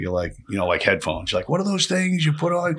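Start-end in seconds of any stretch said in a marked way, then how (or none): 1.5-2.8 put-on confused old man. Imitating confused Alzheimer's man.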